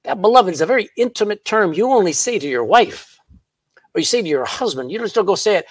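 ordinary delivery

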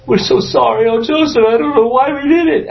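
high, pleading apology of weeping brothers. Acted. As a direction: beg forgiveness in a high, tearful voice.

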